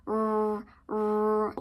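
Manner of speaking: a long buzzing r sound; r sound drill. Shown as a demonstration. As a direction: hold the r before the word. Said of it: "you can make this sound".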